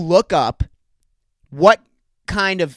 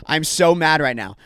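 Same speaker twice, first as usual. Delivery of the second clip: ordinary talk, nothing marked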